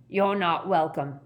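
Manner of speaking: lightly voiced hostile wild man; menacing stranger. Wild man warning off the Ministry visitor, menacing.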